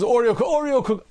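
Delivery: high, eager mock craving. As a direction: squeal the cookie name like a tempted dieter. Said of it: "Someone says".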